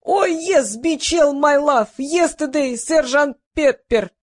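high-pitched burst of broken English; blurting out. A narrator's reading. Igor ordered to speak only English, blurting it out. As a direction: blurt out random English phrases. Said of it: "выпалил Игорь".

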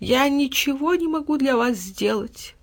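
slow, high, feigned pain; whining cat, falsely sorry; hypocritical mewing. A narrator's reading. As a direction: mew it with false sorrow. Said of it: "с лицемерной болью в голосе промяукал кот".

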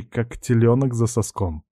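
usual speaking voice

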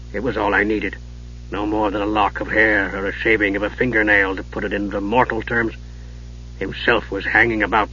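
remember it with regret; sad old dwarf. Acted. Old dwarf's long sad tale.

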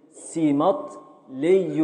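ركنيبل spoken very slowly, syllable by syllable; slow scansion. Shown as a demonstration. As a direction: drag out ركنيبل to show the beats. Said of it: "هنقف على". (تقطيع بطيء جدا)

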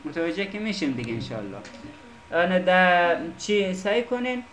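ordinary talk, nothing marked

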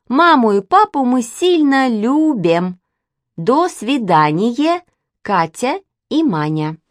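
very slow, laboured, over-enunciated syllables; child's letter reading. A narrator's reading. Katya and Manya write to the mysterious stranger, painstakingly slow. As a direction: sound it out word by word like a young child writing.

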